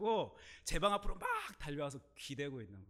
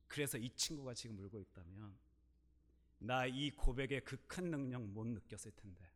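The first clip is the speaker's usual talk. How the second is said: ordinary talk, nothing marked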